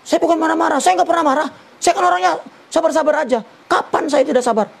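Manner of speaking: mock a wife insisting she never gets angry; imitating defensive wife. Menirukan istri yang menyangkal marah. (menirukan istri yang membela diri, nada tinggi)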